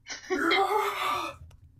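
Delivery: loud, high, drawn-out fan scream; excited scream. Pretend. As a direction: scream at the top of your lungs in mock fangirl joy. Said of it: "screaming on top of my lungs".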